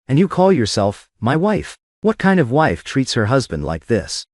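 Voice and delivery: ordinary delivery, nothing marked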